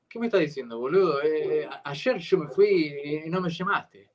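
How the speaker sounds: Buenos Aires accent with "sh" for double L; Argentinian Spanish accent. Shown as a demonstration. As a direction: speak like an Argentinian from the capital. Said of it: "that's Argentinian".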